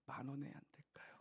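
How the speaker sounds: timid whispered ask; cautious whisper. Retold or imitated. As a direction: ask very softly, hesitantly. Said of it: "조심스럽게 얘기했어요".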